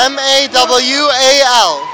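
spelled letter by letter, slowly; slow letter-by-letter spelling for the reporter. Shown as a demonstration. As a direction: spell the name out slowly, one letter at a time. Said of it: "what's it called again".

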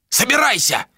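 high barked order at his wife; sharp shout. A narrator's reading. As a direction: snap it out as a command. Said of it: "прикрикнул Каналь на супругу". (резкий окрик)

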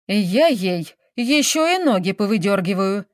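ordinary delivery, nothing marked

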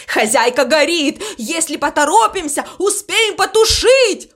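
high panicky cry, climbing as it goes; alarmed shouting. A narrator's reading. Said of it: "кричал Ворон". (тревожный крик, всё выше)

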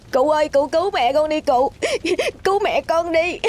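put-on high tearful pleading child; sobbing, high-pitched plea; desperate begging. A narrator's reading. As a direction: beg an elder through sobs. Boy kneels begging old man.

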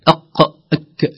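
"أق" heavy, "أك" light; letter contrast. Shown as a demonstration. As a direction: set "أق" against "أك" slowly. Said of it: "المخرج قريب جدًا". (مقارنة "أق" و"أك")

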